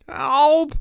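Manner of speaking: high, loud gurgled yell; gurgling cry. A narrator's reading. Harold calls from the creek, gurgling. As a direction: call out through a mouthful of water. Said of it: "came a gurgling voice".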